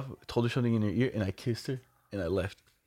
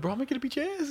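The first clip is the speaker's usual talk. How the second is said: much higher put-on voice, joking; mock tough-guy threat; faster than usual. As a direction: ham up an empty threat.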